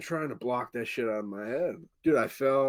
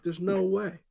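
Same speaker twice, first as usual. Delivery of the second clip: incredulous, higher; 8 semitones higher than usual. Retold or imitated. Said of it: "I'm like".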